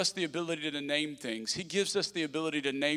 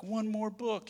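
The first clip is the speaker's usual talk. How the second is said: put-on pleading child; 6 semitones higher, 6 dB quieter, slower than usual. Kids voiced pleading.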